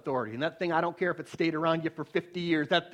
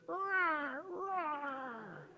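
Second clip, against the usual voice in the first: tiny high mewling roars; soft, squeaky cub straining to roar; kitten-like roar; 9 semitones higher, 12 dB quieter than usual. Acted. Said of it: "sounds like a little kitty".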